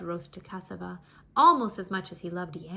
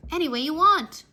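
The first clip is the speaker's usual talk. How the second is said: higher and louder, eager; Anansi cries out; 6 semitones higher, 9 dB louder than usual. A narrator's reading. Starving Anansi crying out to the plantains, eager. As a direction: beg for food with a hungry cry. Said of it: "Anansi cried".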